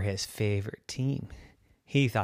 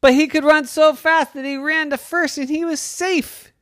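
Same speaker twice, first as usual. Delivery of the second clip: high and louder, racing; telling a child the exciting play, high and loud; excited telling; 6 dB louder than usual. A narrator's reading. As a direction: build the thrill of the dash. Excited narration of the run.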